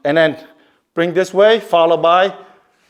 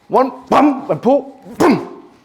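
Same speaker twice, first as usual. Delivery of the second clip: slow count, then high bursts on bum and boom; walk it through slowly, then snap the strikes; slow demo; 7 semitones higher, much slower than usual. Shown as a demonstration. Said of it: "So slow".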